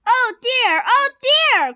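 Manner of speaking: high-pitched, fretful pig; high, falling cries of dismay; frightened cry. A narrator's reading. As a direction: wail it in acted alarm. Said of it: "cried the pig".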